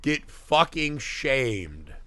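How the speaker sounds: usual speaking voice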